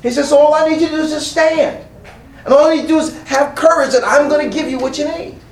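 rising, fervent preacher's call; full-force preaching. Acted. Preacher voicing God to the church, fervent and rising. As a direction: preach it out to the congregation with growing fire.

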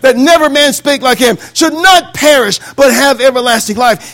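forceful pulpit delivery building to a climax; impassioned preaching. Acted. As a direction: preach it as an urgent testimony. Builds imagined testimony in full preaching.

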